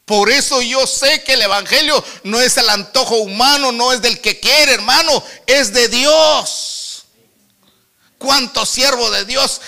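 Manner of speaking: loud, high, insistent sermon delivery; full-force preaching. Real, not acted. Preacher declaring his testimony to the brothers, full-force preaching.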